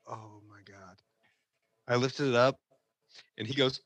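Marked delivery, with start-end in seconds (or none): 0.1-1.0 very soft, low "oh my God"; sinking realisation, barely voiced; hushed dismay. Worker discovers the ruined fish, hushed dismay.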